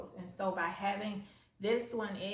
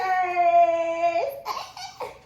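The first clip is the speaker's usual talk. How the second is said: loud, high giddy laugh; 8 semitones higher, 11 dB louder than usual. Real, not acted. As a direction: burst out laughing with joy. Laughs after squealing.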